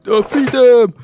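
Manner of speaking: loud, high mocking cry; imitated heckler's shout. Random person shouts at a group of teenagers, imitated jeering cry. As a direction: mimic a stranger jeering at full volume. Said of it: "random person has just went".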